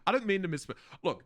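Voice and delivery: usual speaking voice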